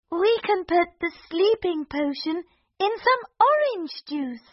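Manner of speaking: high scheming princess voice; eager princess plotting; cartoon princess.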